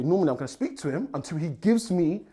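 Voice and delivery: normal talking voice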